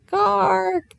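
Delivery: high, pressing croak. A narrator's reading. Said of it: "said the rook again louder".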